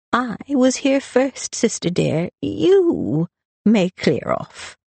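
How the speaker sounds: slow, high, taunting drawl; stretched-out boozy defiance; drunken slur. A narrator's reading. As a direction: throw the order back at her tipsily. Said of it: "the slur in his voice".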